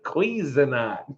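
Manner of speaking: a soft, slow Cuisinart like the recording; imitating the clip. Vlogger mimicking how the clip says it. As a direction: echo the fancy Cuisinart softly. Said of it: "You're like".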